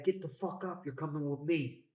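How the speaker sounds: high-pitched mock command from a gunman; gunman's order. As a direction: bark it like a man holding a shotgun.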